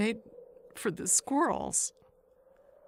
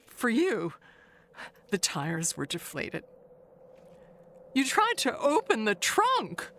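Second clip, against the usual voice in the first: ordinary talk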